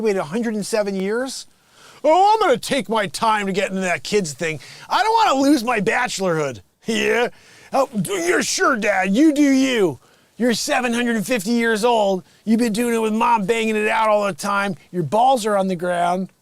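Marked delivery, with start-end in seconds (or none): 2.0-6.6 louder, higher, carefree slacker voice; mock lazy bachelor. 6.8-16.3 loud, high, cheeky sarcastic son; mocking son. Son mocking old father.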